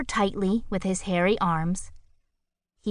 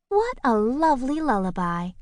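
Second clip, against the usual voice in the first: higher, drowsy, pleased; dreamy bird voice; 6 semitones higher than usual. A narrator's reading. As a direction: coo it half asleep. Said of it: "said Kalinka".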